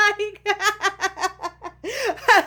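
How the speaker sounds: high burst of real laughter; laughing. Real, not acted. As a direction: crack up mid-sentence at your own story. Laughing at her own story.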